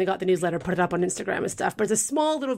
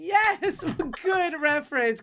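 laughing; 7 semitones higher, much slower than usual. Real, not acted. Laughing at co-host's reference.

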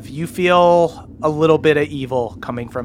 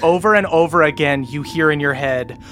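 usual speaking voice

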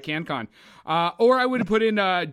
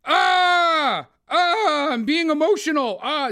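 much higher and louder mock-emotional wails; over-the-top mocking of an actor told to be emotional; hammed-up fake emoting; 10 semitones higher, 6 dB louder, much slower than usual. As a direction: parody a bad actor forcing emotion. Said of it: "and he was like".

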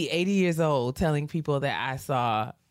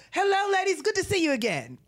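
high, grand greeting; imitating Andre; 14 semitones higher than usual.

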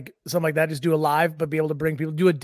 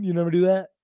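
ordinary talk

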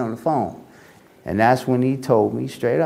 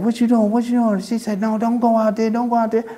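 pleading wife; 11 semitones higher, faster than usual. Retold or imitated.

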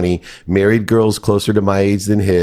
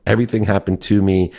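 ordinary talk, nothing marked

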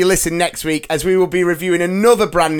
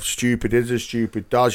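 mock London Gump line; 4 semitones lower, 4 dB quieter than usual. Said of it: "if I was doing you".

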